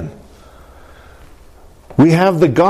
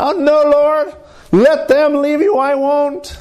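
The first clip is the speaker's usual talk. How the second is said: high, sustained protest to the Lord; hammed-up devoted disciple; proud Peter; 12 semitones higher, slower than usual. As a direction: swear loyalty in a high, drawn-out voice. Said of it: "I'm sure he said in his pride".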